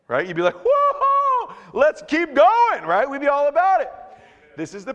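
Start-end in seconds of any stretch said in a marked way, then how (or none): 0.6-2.8 high, loud whooping cheer; amped up. Acted. Preacher acts out a crowd fired up by a miracle, amped up. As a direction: whoop it up, stretching the words out. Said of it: "like, amped, their faith would be amped up".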